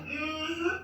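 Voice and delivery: normal talking voice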